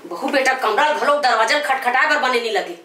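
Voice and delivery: ordinary talk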